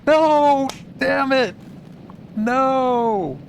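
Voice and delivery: high despairing yells; anguished shouts. Real, not acted. Character dies in game.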